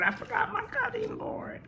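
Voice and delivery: squeaky high dismayed yelp; sudden realization. Real, not acted. Cook realizing she forgot the cutting board, high dismay. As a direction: catch yourself forgetting something.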